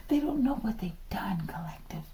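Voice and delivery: gleeful hushed whisper. Real, not acted. Tarot reader whispering to the collective. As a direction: whisper it like a juicy secret.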